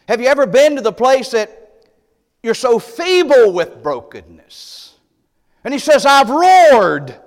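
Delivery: loud, high, fervent cry; roaring pulpit climax; preaching roar. Acted. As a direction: shout it out like a roar. Preacher peaking on roared.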